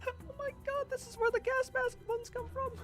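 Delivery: very high, thin little voice; squeaky exclamation. Pretend. Player spotting the gas mask ones, tiny high squeal. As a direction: squeak it out in mock astonishment.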